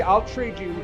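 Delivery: ordinary talk, nothing marked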